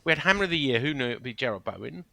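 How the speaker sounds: normal talking voice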